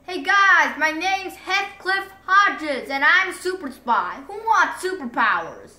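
loud, high, bright announcement; hero's greeting. Retold or imitated. Heathcliff bursting into the cafeteria, loud and high. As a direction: burst in like a superhero. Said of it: "opened up the cafeteria door and said".

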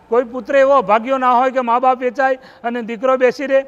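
a son begging to be sold instead; pleading son. Acted. Son pleading with his mother. (ઊંચા અને મોટા સાદે માતાને વિનવતો પુત્ર)

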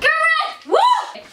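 high, loud gameshow correct and whoop; thrilled shout with a laugh; excited cheer. Real, not acted. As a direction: celebrate the point. Celebrating right answer.

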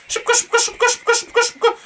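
high-pitched rapid chant; frantic urging. Real, not acted. Gamer running from a guard who turned, frantic urging. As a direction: squeak it over and over in haste. Panicked running away in game.

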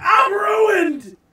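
high, loud cartoon wail; wailed shout. Acting out a bankrupt cartoon brother, wailed shout. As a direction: cry out in hammed-up despair.

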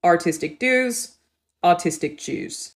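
dues said as jooz, slow and drawn out; Australian jooz demo. Shown as a demonstration. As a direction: stretch out artistic dues with a J sound on dues. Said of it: "In the Australian accent".

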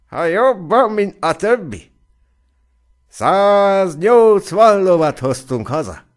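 high, loud bragging cry; boastful shout. A narrator's reading. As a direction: crow proudly about the horses. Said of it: "kiáltott Hannes". (büszke, magas, hangos kiáltás)

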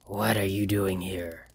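low, slow creature hiss. A narrator's reading. Creature hissing at the stranger who interrupts. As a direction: hiss it at the intruder. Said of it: "it hissed".

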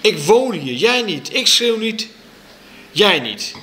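high, insistent quarrelling; angry child. A narrator's reading. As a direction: argue back hotly. Child quarrels with mother's boyfriend. (boos, fel tegensprekend)

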